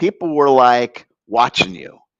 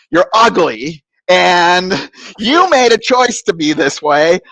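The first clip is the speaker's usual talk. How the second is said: re-enacted telling-off, building higher; angry tirade; 6 semitones higher, 3 dB louder, slower than usual. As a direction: start measured, build to indignant outburst at the priest. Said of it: "with lots of profanity".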